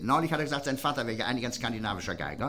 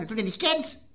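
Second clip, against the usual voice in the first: lightly raised, tinny; a touch higher and tinny; high Lower Rhine voice; 10 semitones higher than usual. Retold or imitated. Barkeeper telling his friend, in a lightly raised tinny voice. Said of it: "so ganz hoch und Metallen sprechen".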